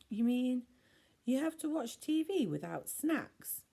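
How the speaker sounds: high, loud whiny complaint; moaning. A narrator's reading. Ella moaning to Lucy about having no snacks. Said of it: "moaned Ella".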